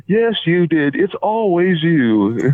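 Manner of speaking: high, drawn-out teasing blame; mock accusation. Wrestler ribbing his old friend from the elevator, mock accusation. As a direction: act out playful, exaggerated accusing.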